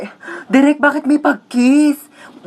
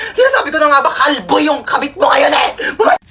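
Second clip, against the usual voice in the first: furious accusation; 3 semitones higher than usual. Acted. Angry accusation, shouted.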